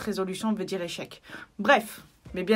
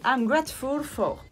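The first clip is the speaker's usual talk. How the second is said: slow, higher English with a heavy French accent; 5 semitones higher, slower than usual. Real, not acted. Reading the English journal heading to viewers, heavy French accent. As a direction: sound out the English carefully in a thick French accent. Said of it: "T'as vu mon accent?".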